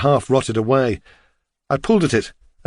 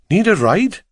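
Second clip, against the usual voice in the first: higher-pitched Welsh cabbie; Welsh accent; 8 semitones higher than usual. A narrator's reading. Taxi driver offers lost walker a lift, Welsh accent. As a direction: call it out in a lilting Welsh accent. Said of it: "asked in his strong Welsh accent".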